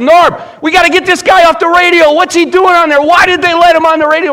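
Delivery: high, loud, urgent rant; agitated outburst. Retold or imitated. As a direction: re-enact an outraged phone call at full volume. Retold agitated call to his friend.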